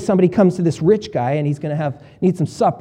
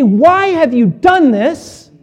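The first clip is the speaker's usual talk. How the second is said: high, slow pleading; slow plaintive question; 8 semitones higher, 4 dB louder, much slower than usual. Pretend. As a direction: ask God slowly, hurt and high. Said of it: "if we say".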